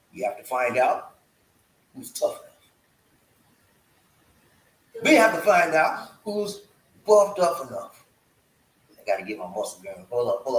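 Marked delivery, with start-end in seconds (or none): 0.1-8.0 spaced-out phrases with long pauses; deliberately slow. Shown as a demonstration. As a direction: lay it out piece by piece. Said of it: "But I gotta say it slow".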